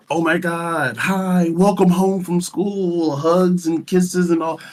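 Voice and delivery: high, bubbly mock welcome-home voice; sing-song hugs-and-kisses greeting, hammed up; gushing doting parent. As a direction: gush like an overly affectionate parent. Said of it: "I'm not really like that".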